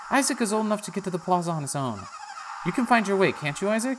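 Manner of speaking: put-on encouraging the boy, high; Dora. Dora reassuring Isaac, high voice. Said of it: "shakes her head and says".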